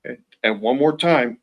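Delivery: normal talking voice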